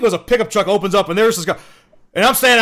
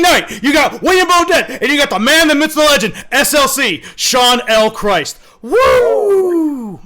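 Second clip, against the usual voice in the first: over-the-top introduction ending in a whoop; hype announcer; 6 semitones higher than usual. Acted. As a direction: hype the crowd like a varsity squad intro. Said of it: "let's take it down a notch".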